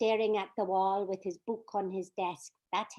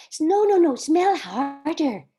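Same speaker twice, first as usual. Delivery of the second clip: louder, slowed urging; eager insistence; 4 semitones higher, 8 dB louder than usual. Acted. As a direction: insist excitedly. Urging friend to smell dress.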